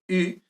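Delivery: "U" said with rounded lips like French u, as in "lune"; French u sound. Shown as a demonstration. Teacher modelling Greek upsilon, "U" like French u. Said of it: "como la U del francés".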